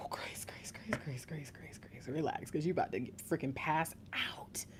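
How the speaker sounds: whispered, repeated self-talk; calming herself down. Real, not acted. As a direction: whisper urgently to yourself to relax. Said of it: "I gotta calm down for a second".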